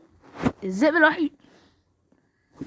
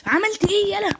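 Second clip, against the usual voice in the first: excited yelling; 6 semitones higher than usual. Real, not acted. Gamer yelling at a fleeing opponent in the fight. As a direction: egg the enemy on, voice up high.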